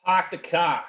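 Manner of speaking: put-on Boston accent, dropped R in park and car; exaggerated Boston accent. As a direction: say it like a Bostonian, no R sounds. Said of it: "can you say, like, "Park the car"?".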